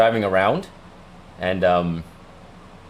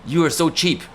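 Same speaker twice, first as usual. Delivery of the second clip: higher, scolding mock father; imitating dad's scolding, pitched up; nagging dad; 6 semitones higher than usual. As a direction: whine at him like a stingy-hating dad. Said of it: "He was like".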